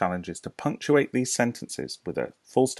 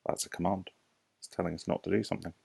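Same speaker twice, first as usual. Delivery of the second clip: normal talking voice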